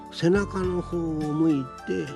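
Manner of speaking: ordinary delivery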